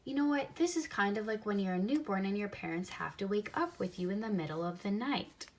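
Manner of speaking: usual speaking voice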